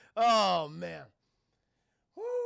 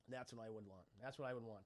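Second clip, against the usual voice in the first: soft and low; 8 semitones lower, 17 dB quieter than usual. Real, not acted. Dreamy food craving.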